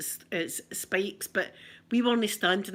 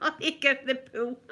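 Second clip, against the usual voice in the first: laughing; 5 semitones higher, faster than usual. Real, not acted. Laughing at her own panic.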